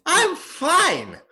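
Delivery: very high, strained insistence; high-pitched indignant cry; defensive outburst. Acted. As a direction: protest far too much. Insists loudly he is fine.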